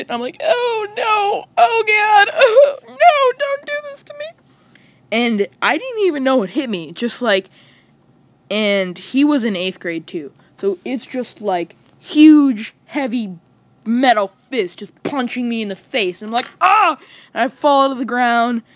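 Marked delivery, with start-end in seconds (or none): 0.4-4.2 very high, whimpering wail; mock tearful begging. Boy to attacker before a punch, mock tearful begging. 16.6-17.0 short, high, loud mock scream; yelp of pain. Acted cry when punched.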